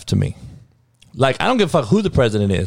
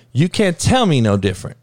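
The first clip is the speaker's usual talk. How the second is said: ordinary delivery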